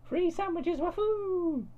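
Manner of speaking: put-on high, slow squeaky girl voice; cutesy anime girl. A narrator's reading. Cud voiced in story.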